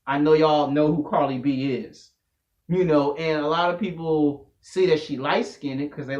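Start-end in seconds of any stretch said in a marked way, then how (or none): none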